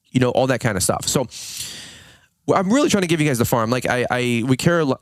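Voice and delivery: usual speaking voice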